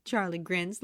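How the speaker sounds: ordinary delivery, nothing marked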